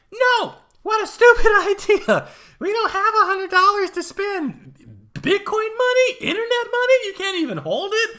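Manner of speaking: loud, very high-pitched shrieking imitation of a wife; mock outraged wife. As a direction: shoot the idea down in a hammed-up falsetto yell. Acted-out wife rejecting his idea.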